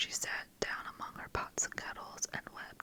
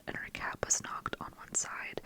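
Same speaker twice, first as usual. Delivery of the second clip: usual speaking voice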